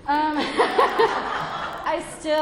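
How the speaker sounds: laughing nervously through the restart; a shaky giggle, then pick back up. Real, not acted. Speaker at a meeting, laughing at her own restart.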